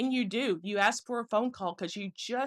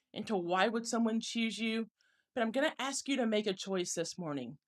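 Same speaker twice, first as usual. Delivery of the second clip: ordinary delivery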